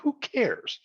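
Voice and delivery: high-pitched dismissive dad. Retold or imitated. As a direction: toss it off high, like an unimpressed father. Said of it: "My dad was like".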